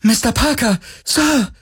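put-on high, bright-eyed calling out with childlike delight; childlike joy. A narrator's reading. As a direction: call to him like an overjoyed child. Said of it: "mit fast kindlicher Freude". (mit fast kindlicher Freude rufen)

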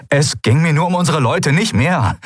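high-pitched, urgent insistence; desperate. A narrator's reading. Mate justifying himself to his captain, desperate. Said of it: "wie verzweifelt er klang".

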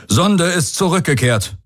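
high-pitched military report; crew report. A narrator's reading. Crew member reporting the probe, high formal voice. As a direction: report crisply to the commander. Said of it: "meldete ein Crewmitglied".

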